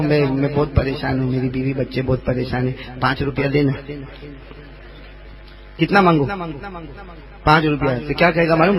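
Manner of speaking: normal talking voice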